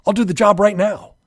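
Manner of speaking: put-on a kid loudly promising to fix it; begging his dad at full volume; pleading teen. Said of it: "These are the common arguments you'll hear".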